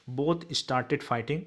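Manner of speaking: very slow, each word drawn out; slow dictation. Shown as a demonstration. Teacher dictating to students, very slow and deliberate. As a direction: spell it out in time for pupils to write. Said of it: "teacher dictates English answer".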